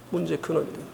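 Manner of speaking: 문제의 근원이 돼요 dropped to a near hush. Shown as a demonstration. (조용히 되풀이하며)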